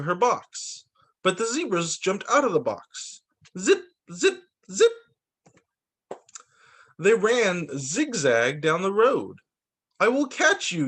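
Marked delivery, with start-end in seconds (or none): 3.6-4.9 zippy sound effect. Acted. Zebras zipping away, sound effect. 10.0-10.9 calling out. Acted. Little Z calling after zebras.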